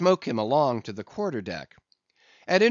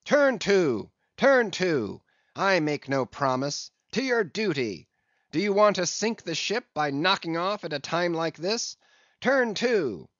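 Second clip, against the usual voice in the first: commanding shout; 6 semitones higher than usual. A narrator's reading. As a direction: hammer each turn to like a command. Captain ordering mutineers.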